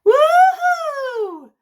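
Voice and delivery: whooping cheer. Acted. Child celebrating catching fish.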